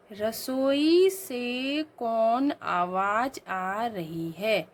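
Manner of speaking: very slow reading of the odd wrong question; slow dictation. Shown as a demonstration. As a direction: dictate it slowly. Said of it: "ये कुछ अजीब सा नहीं लग रहा". (धीमे से गलत वाक्य पढ़ना)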